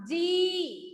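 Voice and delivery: "जी" with the ee held long; long ee vowel. Shown as a demonstration. Teacher correcting Hemant's answer, long-drawn "जी". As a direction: stretch out the vowel of "जी". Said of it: "लंबा हो जाता है".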